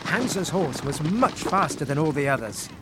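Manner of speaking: ordinary delivery, nothing marked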